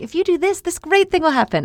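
high, bright sing-song promise; cheery reward pitch. Retold or imitated. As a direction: sell it like a cheerful prize.

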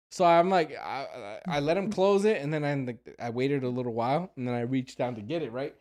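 usual speaking voice

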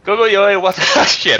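high, loud intro cracking into laughter; breaking character giggling. Pretend. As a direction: try to stay in character but giggle. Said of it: "I can't do it with a straight face".

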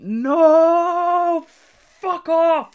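drawn-out, very high wail of disbelief; loud, sky-high howl of protest; re-enacted scream. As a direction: scream it out like a gutted fan. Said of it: "like that".

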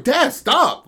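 put-on high, loud whine of a kid in a headlock; embarrassed teen begging dad to stop; whiny teen protest. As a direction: squeal it out like a teen pinned by dad. Son in a headlock.

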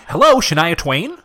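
ordinary talk, nothing marked

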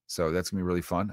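ordinary delivery

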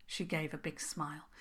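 usual speaking voice

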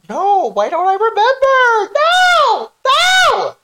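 high shrieking no; frustrated shriek. Real, not acted. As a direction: scream no in frustration. Frustrated forgetting a name.

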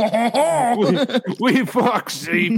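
slow mock French accent; a hint of a French accent, laughing. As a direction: drawl it out in a hammy French accent.

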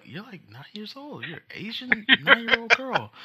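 high, laughing disbelief; incredulous. Retold or imitated. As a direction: squeal out the obvious. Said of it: "And I was like".

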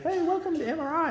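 put-on high, soft old-lady greeting; nice old lady. Old lady greeting an MRI patient, sweet and high. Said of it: "nice little old lady".

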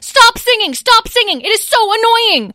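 high, loud furious yelling; annoyed little dragon yelling at her sibling; angry yell. Acted. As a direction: scream it at her brother. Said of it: "yelled Petra".

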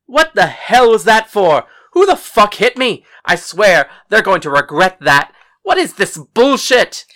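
loud, furious outburst; angry shouting. A narrator's reading. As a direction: yell like you just got hit. Colin wakes up swinging, furious.

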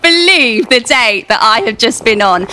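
breathless, laughing call to housemates; bursting through the front door, calling to her housemates through laughter; excited shout. Acted. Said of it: "I shout".

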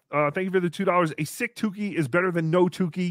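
ordinary talk

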